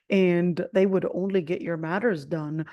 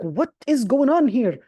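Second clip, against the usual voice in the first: louder, higher challenge from the official; official's loud demand; 5 semitones higher, 6 dB louder than usual. Retold or imitated. Intelligence officer confronting the shouting visitor, loud and sharp. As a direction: bark it like an official catching a troublemaker. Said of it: "he was like".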